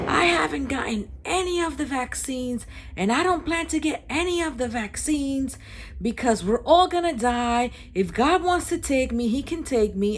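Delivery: high-pitched mimicked yell; mock yelling. Unmasked man ranting to the whole bakery, imitated yelling. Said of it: "starts yelling at the top of his lungs".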